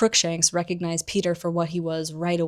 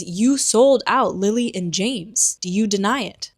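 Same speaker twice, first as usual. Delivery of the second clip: accusing; 3 semitones higher, 6 dB louder than usual. Retold or imitated. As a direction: hammer out each word of the charge.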